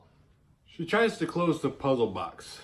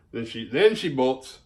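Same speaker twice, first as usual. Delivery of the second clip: normal talking voice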